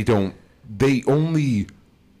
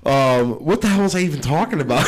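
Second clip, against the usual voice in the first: usual speaking voice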